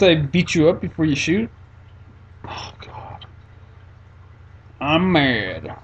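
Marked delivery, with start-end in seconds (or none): 2.5-3.2 breathless whispered oh God; whispered groan. Real, not acted. Gamer whispering in dismay at his screen. Frustrated whisper while gaming.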